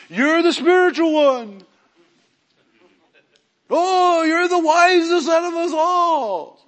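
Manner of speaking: high, slow, gushing praise; fawning flattery. Retold or imitated. Church members fawning over the titled one, gushing. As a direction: lay on the flattery thick. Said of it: "everybody goes".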